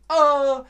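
high, loud mock scream; playful "aah" of stress; overwhelmed scream. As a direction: cry out as if it is all too much. Acting out being overwhelmed.

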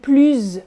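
PLUS ending in a buzzed Z; PLUS with liaison Z. Shown as a demonstration. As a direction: say PLUS as "plooz". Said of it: "when you're making the liaison".

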